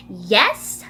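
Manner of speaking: a touch of rudeness on a sharply rising question; lightly acted snippy "what is it"; mildly rude. A narrator's reading. Annie answers Mom before getting downstairs, mildly rude. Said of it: "Annie said rud-, rudely".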